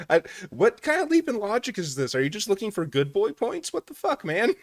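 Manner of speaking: high, baffled disbelief; incredulous. Real, not acted. Streamer questioning the man's logic, high baffled disbelief. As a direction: pitch up in utter disbelief.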